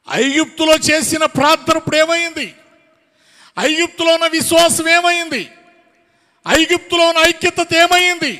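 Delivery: shouted rhetorical questions from the pulpit; full-force preaching. Real, not acted. Preacher demanding what became of their prayer and unity, full-force preaching. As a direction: hammer each question at the congregation.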